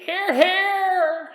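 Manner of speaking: put-on high-pitched old lady cheer; little old lady. Little old lady backing the fundraiser, cheering in character. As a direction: call it out high and drawn out like an old woman. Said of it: "As you hear a little old lady".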